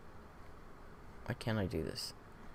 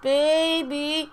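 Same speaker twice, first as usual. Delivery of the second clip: put-on baby talk; 10 semitones higher, 4 dB louder than usual. Mocking cooing at video.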